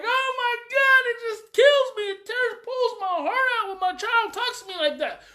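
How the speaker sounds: high-pitched mock wail of a hurt parent; hammed-up heartbroken parent lament; distraught parent. Parent hurt by child's words, mock anguished. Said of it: "most parents are like".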